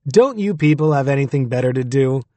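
usual speaking voice

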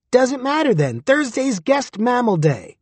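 high, squeaky little critter. A narrator's reading. Durden's dick answering him, high squeak. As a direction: pipe it up high and cheeky.

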